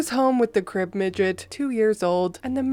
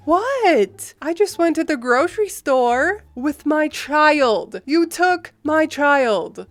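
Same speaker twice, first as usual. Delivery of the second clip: higher, indignant mom protesting; mom's defensive voice; 5 semitones higher, 3 dB louder, slower than usual. Retold or imitated. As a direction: mom brushing off the accusation, pitched up. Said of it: "It was my mother".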